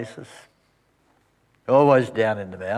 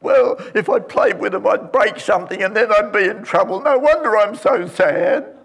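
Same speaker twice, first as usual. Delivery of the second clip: put-on higher, louder whining boy, slowing at the end; sulky sad child; 10 semitones higher, 5 dB louder than usual. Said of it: "crying his eyes out".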